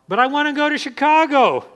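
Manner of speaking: high mock-protesting traveller; mock insistence. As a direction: play the stubborn driver who wants Chicago. Imagined traveller insisting on Chicago.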